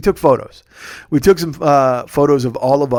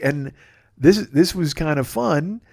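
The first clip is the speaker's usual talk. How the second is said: ordinary talk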